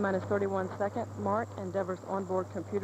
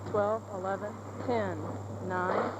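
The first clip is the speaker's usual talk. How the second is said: steady launch countdown call; counting down to go; slower than usual. Real, not acted. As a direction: count down to liftoff, measured and firm.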